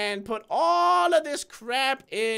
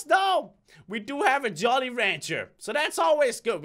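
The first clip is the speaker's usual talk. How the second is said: ordinary talk, nothing marked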